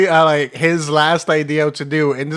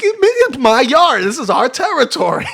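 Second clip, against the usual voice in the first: raccoon's voice; 12 semitones higher, 7 dB louder than usual. Retold or imitated. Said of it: "he's like".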